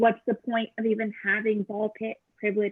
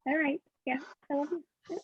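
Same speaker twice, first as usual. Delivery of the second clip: soft goodnight; 3 semitones higher, 8 dB quieter than usual. Acted. Whispering goodnight to partner.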